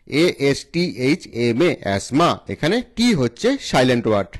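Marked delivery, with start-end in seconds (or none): none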